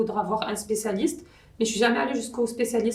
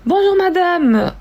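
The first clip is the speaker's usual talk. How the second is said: high, loud, drawn-out greeting; bank phone greeting; 9 semitones higher, 11 dB louder, slower than usual. Acted. Bank call skit about blocking a card, exaggerated high greeting. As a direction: sing out a hammed-up high hello. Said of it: "Je joue Sly quand".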